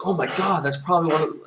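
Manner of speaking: high mock alarm; panicked wolf. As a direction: squeal in mock panic at the knocking. Panicked wolf hears knocking.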